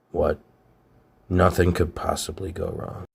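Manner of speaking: normal talking voice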